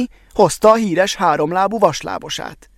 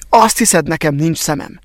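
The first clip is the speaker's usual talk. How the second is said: ordinary delivery, nothing marked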